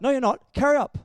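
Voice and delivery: mock yell at a flagging runner; crew yelling. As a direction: bark it like a pushy coach.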